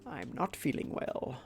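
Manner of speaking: stuffy, sniffly sick voice; talking through a cold. Real, not acted. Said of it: "the sick voice".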